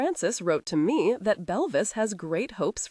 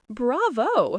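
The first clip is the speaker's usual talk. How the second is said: shouted bravo; 6 semitones higher than usual. A narrator's reading. Husband applauding his wife's act, ironic shout. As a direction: call out bravo with irony. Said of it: "he shouted".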